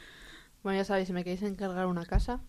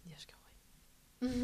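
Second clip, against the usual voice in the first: whisper; 3 semitones higher, 13 dB quieter, much slower than usual. Real, not acted. Awed hushed reaction to idea.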